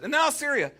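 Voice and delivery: and NOW, as if this is the last straw; exasperated outburst. Retold or imitated. Preacher mimicking the psalmist's last-straw cry. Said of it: "that's exactly how he says it".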